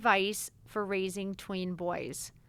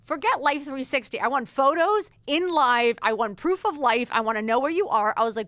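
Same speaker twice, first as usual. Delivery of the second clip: louder, higher demanding voice; hammed-up strict mom laying down the law; mock yell; 6 semitones higher, 9 dB louder than usual. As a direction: play the overprotective mom demanding proof. Retold demanding text to kids.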